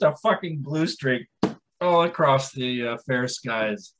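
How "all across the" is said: ordinary talk, nothing marked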